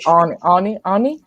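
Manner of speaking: "Arnie" with a long AH; exaggerated vowel. Shown as a demonstration. Joking back about their pronunciation, "Arnie" as AH-nee. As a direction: stretch the first sound of "Arnie" to AH. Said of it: "Should it be Arnie".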